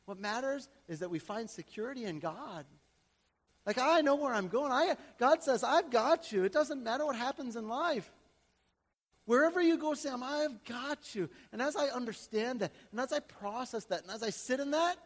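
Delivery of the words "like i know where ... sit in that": 1 impassioned preaching. Acted. Preacher rises again.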